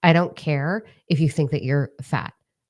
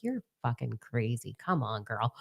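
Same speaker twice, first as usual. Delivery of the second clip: much softer, lower dismissive aside; imitating unimpressed friend; 4 semitones lower, 11 dB quieter than usual. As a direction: drop low and quiet to mimic the doubter. Imitating Corey's deadpan reply.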